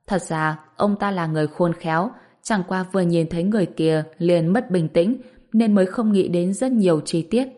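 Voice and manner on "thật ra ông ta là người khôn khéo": ordinary delivery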